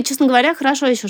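ordinary delivery

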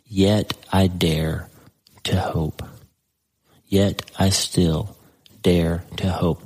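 slow emphasis. Acted. Preacher dwells on the hope verse.

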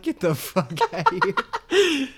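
high, slow retort breaking into laughter; laughing mock outburst, drawn out; mock frustration, laughing. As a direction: crack up while imitating a fed-up boss. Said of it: "throws the sandwich in frustration".

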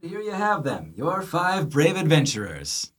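muffled from behind the door. Acted. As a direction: voice heard through the wagon wall. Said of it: "you hear this muffled voice".

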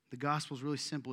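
ordinary delivery

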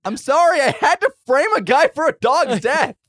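high, loud defensive yelling; shouted excuse. Acted. Player answers the threatening passenger, high shouted excuse. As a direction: yell your excuse back at the attacker.

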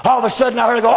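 ordinary talk, nothing marked

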